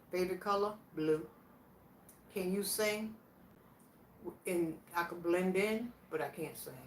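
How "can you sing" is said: usual speaking voice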